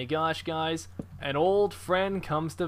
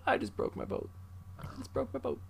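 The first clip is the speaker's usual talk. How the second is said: stunned whisper; 7 semitones higher, 9 dB quieter than usual. Real, not acted. Whispered after crash.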